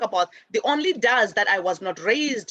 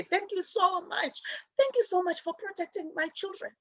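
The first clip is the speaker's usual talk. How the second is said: a slowed, softer grateful parent; 7 semitones higher, 6 dB quieter than usual. Retold or imitated. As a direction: thank the neighbor from the heart. Parent thanking the disciplining neighbor.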